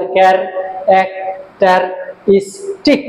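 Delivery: care, এক, ter, is, tic in separate beats; syllable by syllable. Shown as a demonstration. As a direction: say characteristic slowly with short gaps between syllables. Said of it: "পাঁচটা syllable আছে".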